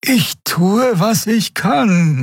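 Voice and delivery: high, drawn-out, under pressure; strained reply. A narrator's reading. Pilot answers Miko's urging, strained high voice. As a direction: answer with effort while pushing the ship. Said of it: "murmelte sie".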